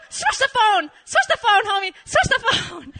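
high, loud repeated demand; urgent yelling. Retold or imitated. Wants the Korean friend to take over, urgent high yelling. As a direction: bark it at the friend.

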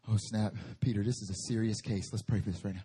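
low, soft worried disciples; lightly voiced nervous disciples; a hint of a low, hushed disciple. Disciples voiced in retold story.

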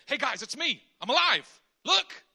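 loud, high, pleading call; urgent Jesus. Pretend. Jesus calling the leaving disciples back, loud and pleading. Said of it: "I would just say".

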